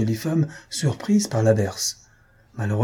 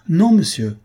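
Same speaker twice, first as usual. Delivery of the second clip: louder, higher, emphatic denial; indignant retort; 7 semitones higher, 6 dB louder than usual. A narrator's reading. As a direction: cut him off with an indignant no. Concierge angrily corrects the visitor. (démenti indigné, plus fort et plus aigu)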